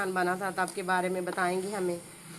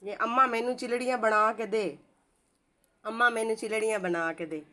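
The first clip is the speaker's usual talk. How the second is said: put-on a child's plea to mother in Saraiki-like village speech, a bit louder; Saraiki-like village dialect; 2 semitones higher, 5 dB louder than usual. Shown as a demonstration. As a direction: beg your mother for chilaRiyan in the Saraiki-like village tongue. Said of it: "तो हम ऐसे बोलते हैं". (सराइकी जैसी गाँव की बोली में माँ से मिन्नत)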